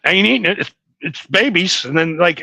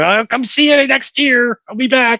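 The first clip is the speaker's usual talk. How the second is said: put-on childlike promise; 8 semitones higher than usual. Kid promising pig to return.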